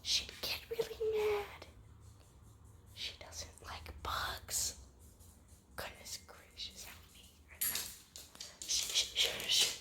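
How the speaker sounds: hushed unvoiced whisper. Real, not acted. Owner whispering beside sleeping dog Suki. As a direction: keep it to a breath so she sleeps. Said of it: "We gotta step ever so quietly".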